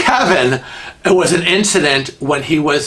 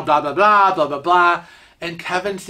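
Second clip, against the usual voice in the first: ordinary delivery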